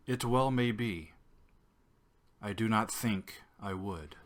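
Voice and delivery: measured, emphatic recitation with long pauses between phrases; poetry reading voice. Shown as a demonstration. Reading a beloved sonnet aloud to listeners, in poetry reading voice. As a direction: declaim the poem, leaning hard on key words, easing down at line ends. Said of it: "my poetry reading voice".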